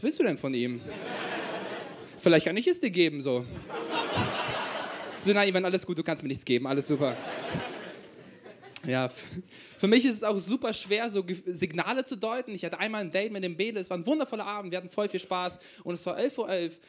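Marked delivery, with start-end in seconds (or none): none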